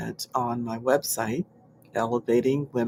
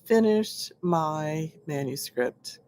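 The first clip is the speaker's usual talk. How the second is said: usual speaking voice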